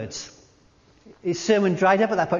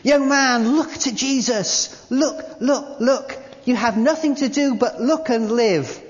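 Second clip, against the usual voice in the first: higher and louder preacher's shout; shouted preaching; 5 semitones higher, 4 dB louder, slower than usual. Retold or imitated. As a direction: lift the hands and shout the call. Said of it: "he shouted as only a primitive Methodist could do".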